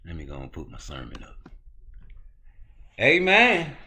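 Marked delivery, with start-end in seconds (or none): 3.0-3.7 high, loud preacher's amen. Acted. Host playing preacher, calling out a loud amen. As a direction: call out amen to open the sermon. Starting a mock sermon.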